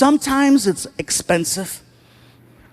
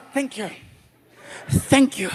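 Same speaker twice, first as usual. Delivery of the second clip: put-on soft drunken thank-you; lightly voiced tipsy gratitude; 2 semitones higher, 5 dB quieter than usual. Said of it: "if I'm drinking, I'm like".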